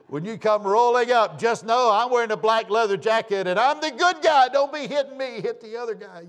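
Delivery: high, loud re-enacted alarm; urgent shouted instructions. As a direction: call out frantically to arriving police.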